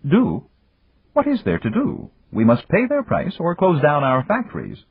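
Yamagata. A narrator's reading. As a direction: tease an old friend from a position of power.

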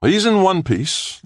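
high, drawn-out cheerful reassurance. A narrator's reading. Doctor reassuring a worried wife, cheerful and high-pitched. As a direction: announce good news cheerily, pitch well up, slowly. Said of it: "Littlejohn said cheerfully".

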